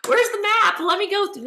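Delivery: high-pitched mock crying, begging to get through it; mock tearful pleading. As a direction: plead through pretend tears, voice pushed way up. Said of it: "I'll start crying".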